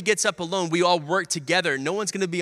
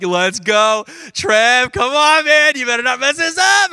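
loud, much higher rowdy cheering; teammates hyping up; 7 semitones higher, 8 dB louder than usual. Retold or imitated. Teammates yelling at the shooter, loud excited cheering. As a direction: shout him on like a pumped-up teammate. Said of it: "And everyone was like".

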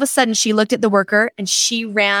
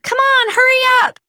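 put-on high, loud yell of impatient kids; 3 dB louder than usual. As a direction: hurry her up like a bored kid shouting. Impatient kids in line yelling.

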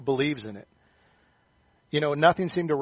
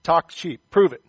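louder, higher taunting challenge; childish dare; 4 semitones higher, 9 dB louder than usual. Retold or imitated. Kid daring a boasting friend, taunting. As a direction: dare them like a schoolkid. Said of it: "what you'd say to him".

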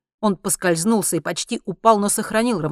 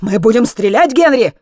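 loud, high-pitched threat; shouted warning; 6 semitones higher, 11 dB louder than usual. A narrator's reading. Alex warns Henry they will shoot, shouting. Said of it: "крикнул Алекс".

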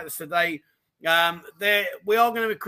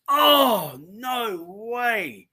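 surprised; 4 semitones higher, 3 dB louder, much slower than usual. Real, not acted. Delighted by comment gift.

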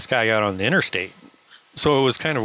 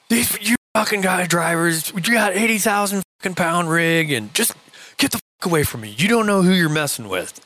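imitating the biker's angry threat, pitched high; high, irate mock tirade; retold threatening rant; 8 semitones higher than usual. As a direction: threaten them as the raging biker. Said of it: "where he's threatening me".

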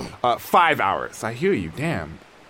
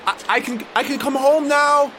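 joyful realization; 12 semitones higher, 4 dB louder than usual. Acted. Realizing he can go home.

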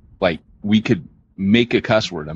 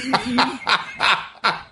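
high burst of real laughter; 4 dB quieter than usual. Real, not acted. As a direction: crack up after the quote.

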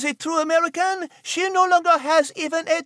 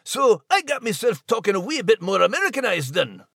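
lower-pitched Scottish brogue, a bit hammed; Scottish accent; 5 semitones lower than usual. Scot laments losing his accent, in Scottish brogue. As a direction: roll it out like a proud old Scot. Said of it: "being a Scottish American myself".

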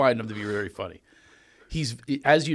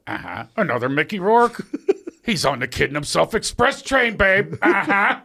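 sassy mock teacher aside, rising higher; imitating the teacher; 8 semitones higher, 4 dB louder than usual. Teacher mocks students to a friend, higher and catty. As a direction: camp up the catty acting coach. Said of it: "he would sit there and go".

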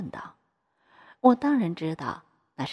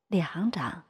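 usual speaking voice

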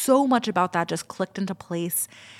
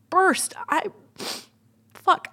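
real tears breaking through mid-sentence; 12 semitones higher, slower than usual. Real, not acted. Retelling the ceremony, she suddenly cries for real. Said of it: "I didn't think I was gonna cry".

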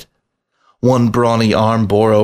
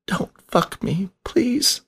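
high, slow, soft pleading whine, voice cracking on please; a small frightened rat whimpering to be spared; meek whine; 11 semitones higher, 6 dB quieter, slower than usual. A narrator's reading. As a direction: beg meekly, the voice climbing until it cracks. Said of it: "a meek whine, a trill of voice barely building to a crack".

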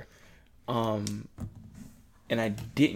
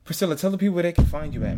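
fast, loud and higher sign-off; upbeat host plug; 7 semitones higher, 6 dB louder, much faster than usual. Real, not acted. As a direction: hype the guest's handles. Host wraps up and hands over.

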